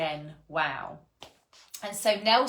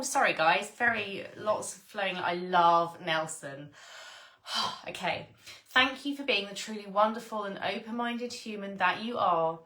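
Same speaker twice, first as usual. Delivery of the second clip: ordinary delivery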